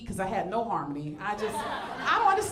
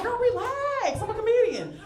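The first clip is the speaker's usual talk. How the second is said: sassy reassurance; 9 semitones higher, 3 dB louder than usual. Retold or imitated. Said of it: "I'm like".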